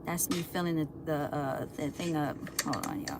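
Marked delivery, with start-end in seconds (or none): 2.6-3.2 hushed aside. Real, not acted. Sneaking up on hiding cat.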